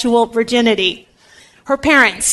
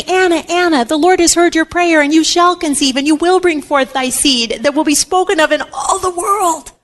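lightly voiced heavenly herald, pitched higher; proclaiming angel; 5 semitones higher than usual. An angel answers Anna's prayer, lightly acted proclamation. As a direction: deliver good news as a solemn, higher proclamation. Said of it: "an angel of the Lord stood by her, saying".

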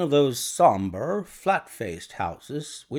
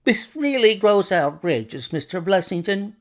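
high, louder outburst of protest; indignant cry; 8 semitones higher, 5 dB louder than usual. A narrator's reading. Doctor Trevelyan protesting to Mister Blessington, indignant cry. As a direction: cry out in outrage, pitched well up. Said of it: "cried Doctor Trevelyan".

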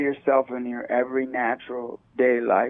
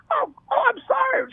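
1 startled apology. Retold or imitated.

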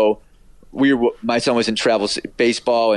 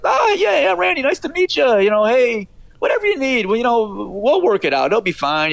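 much higher-pitched mock friendly greeting; imitating friendly colleague; 12 semitones higher than usual. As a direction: do an eager, agreeable colleague in a high voice. Retelling colleague's easygoing, friendly greeting.

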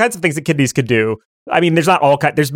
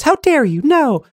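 scandalized left kidney; 11 semitones higher than usual. Acted. Left kidney rebuking its twin, high and outraged. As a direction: cry out high, appalled. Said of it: "Left Kidney goes".

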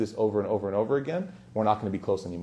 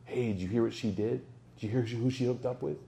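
lightly acted gossip, voice dropped softer; a touch of furtive rumor-spreading; hushed gossip; 9 dB quieter than usual. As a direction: pass on a rumor on the quiet. Acts out someone spreading a rumor.

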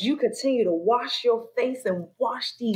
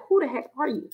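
startled at oneself; 6 semitones higher than usual. Retold or imitated. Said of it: "it's like".

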